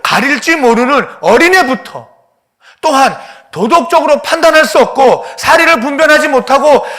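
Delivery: very loud, high shouted preaching; preaching at full force. Acted. God pleading with Jonah for Nineveh, full preaching shout. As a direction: pour God's passion out at full voice.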